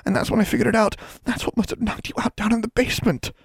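put-on fast, high rushed girl's voice. A narrator's reading. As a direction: spill it all out quickly. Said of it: "Anna whispered and spoke in a rush".

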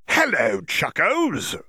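high, sharp, whiny greeting; sharp whiny creature. Acted. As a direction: taunt them like a gleeful pest. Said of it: "sharp, whiny voice".

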